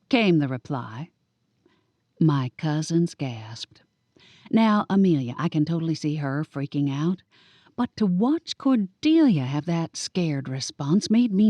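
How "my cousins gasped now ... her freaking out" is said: ordinary delivery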